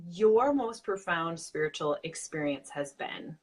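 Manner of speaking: ordinary talk, nothing marked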